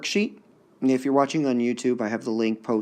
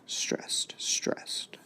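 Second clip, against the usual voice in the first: near-whispered, much softer; hushed repetition; 11 dB quieter than usual. Real, not acted. Teacher marking each word, hushed.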